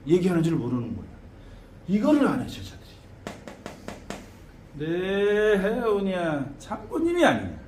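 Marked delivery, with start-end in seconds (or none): 4.7-7.6 drawn-out ritual proclamation; shaman invocation. Shown as a demonstration. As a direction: intone it as a spirit general announcing himself. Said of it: "이렇게 얘기를 해야 되는데".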